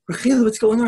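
worried mother. Acted. Mother sits by sobbing daughter, high worried question. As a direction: plead with your crying child.

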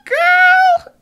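a very high, loud squeak of shock; the word jumps into a shriek. Real, not acted. Player watching a murderer approach a girl, shrieking in shock.

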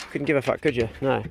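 usual speaking voice

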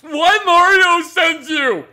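loud, high, drawn-out oafish wail; dim-witted brute. Acted. Dim companion interrupting Virgil, slow loud wail. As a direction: bellow it slowly like a big baby.

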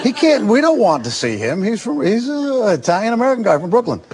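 high-pitched imitation of casting people; mock a casting agent ruling someone out; hammed-up refusal, voice pitched high; mock dismissive. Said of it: "those people would say".

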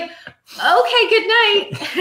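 very high, sing-out goodnight; giddy goodnight. Retold or imitated. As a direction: retell a barely contained, triumphant bedtime send-off. Hiding triumph in retold bedtime goodnight.

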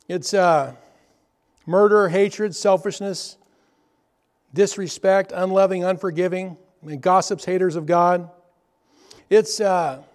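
loud preaching voice, hammering each sin. Acted. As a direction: preach the list at full force. Preaching a list of sins.

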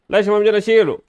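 frustrated shout. Real, not acted. Gamer can't remove the baby's diaper, frustrated shout. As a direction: yell at the stuck diaper.

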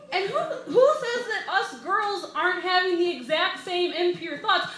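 loud, high comic outrage, slowing at the end; indignant rant. Real, not acted. Comic ranting to the crowd about girls' thoughts, loud and high. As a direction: build the rant with a laugh.

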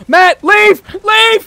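loud, high shouted command; urgent shout. Real, not acted. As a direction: shout to get him out now. Urgent yelled warning to teammate.

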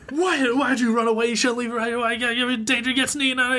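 high, panicky imitated scolding; hammed-up parental panic; mock freak-out. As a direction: act a frightened dad scolding his runaway child. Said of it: "freaking out".

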